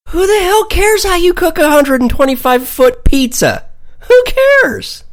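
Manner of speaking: high, loud, incredulous yell; exasperated shout. Acted. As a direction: throw up your hands and yell it. Mock outrage at the pizza question.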